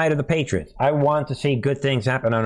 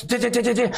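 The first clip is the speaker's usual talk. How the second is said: high rapid-fire stutter of bullets; imitated gunfire; 10 semitones higher, faster than usual. Acting out being hit with the bullets, high gunfire noise. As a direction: mimic machine-gun fire, laughing through it.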